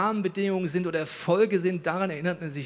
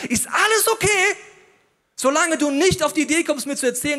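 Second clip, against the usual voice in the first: mock tolerant; 8 semitones higher, 5 dB louder than usual. Mocking society's tolerance.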